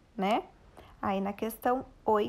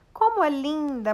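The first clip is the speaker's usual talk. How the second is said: admiring exclamation, pitch up and louder; exclaimed; 5 semitones higher, 5 dB louder than usual. Shown as a demonstration. Teacher reading the dwarfs' exclamation with delight. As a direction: read it out with delight.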